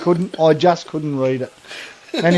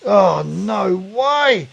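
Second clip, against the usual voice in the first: high, very drawn-out disbelief; mock disbelief; 4 semitones higher, much slower than usual. Lad answers his mates about the stain, drawn-out mock disbelief. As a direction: stretch it out, imitating an appalled mate.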